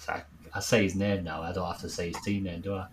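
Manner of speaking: ordinary delivery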